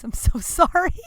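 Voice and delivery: giggling through the words; real laughter breaking the line; laughing apology. Real, not acted. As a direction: laugh it out, embarrassed. Laughing at own grossness.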